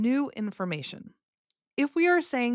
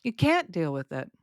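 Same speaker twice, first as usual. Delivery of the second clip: "can't" raised and long, "deal with it" falling; stress on CAN'T; 2 semitones lower than usual. Shown as a demonstration. Teacher tests listeners with a no example, stress on "can't". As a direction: punch "can't", let the rest drop. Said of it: "where I put the stress".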